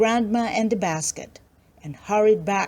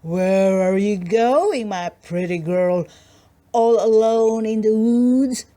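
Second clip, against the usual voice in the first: louder, slow wolf voice; wolf stops the girl on the path, louder and slow; gruff wolf; 4 dB louder than usual. Acted. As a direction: loom over her with the wolf's voice. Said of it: "a gruff voice which said".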